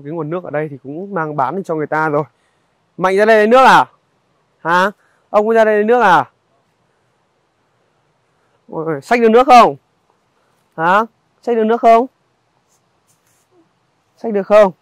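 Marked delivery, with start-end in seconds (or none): 3.0-14.7 raised voice, calling across to someone at a distance. Real, not acted. Vlogger calling across to grandfather and boy at the stream, loud call. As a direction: call out loud so they hear you far off.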